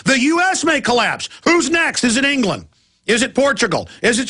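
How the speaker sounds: real alarmed shout; rising shout. Real, not acted. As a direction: pitch leaps up into a shout. Ranting about collapsing countries.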